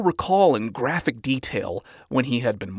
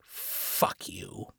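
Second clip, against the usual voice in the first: spat; 8 semitones lower, 15 dB quieter than usual. Acted. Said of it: "the radio demon spat".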